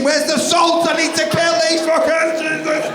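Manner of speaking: high, loud frantic shouting; frantic disgusted yell. Retold or imitated. Homeowner finding slugs in his kitchen, high frantic disgusted yell.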